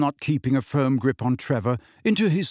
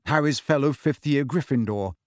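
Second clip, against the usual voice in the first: ordinary delivery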